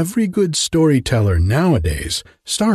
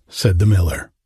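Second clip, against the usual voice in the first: ordinary delivery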